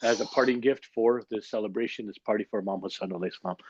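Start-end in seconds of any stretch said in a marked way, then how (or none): none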